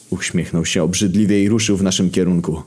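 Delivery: ordinary delivery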